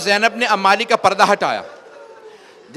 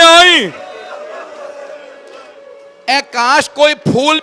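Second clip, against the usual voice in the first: loud, high, wailing preacher's lament; majlis recitation at full force; 6 semitones higher, 4 dB louder than usual. Acted. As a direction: cry the lament out to the mourners.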